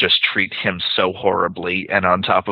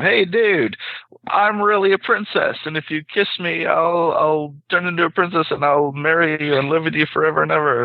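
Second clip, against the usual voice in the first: much higher, squeaky talking frog; 8 semitones higher than usual. Acted. Talking frog in a joke begs the guy, high squeaky voice. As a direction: pitch way up like a cartoon frog pleading. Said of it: "the frog says to him".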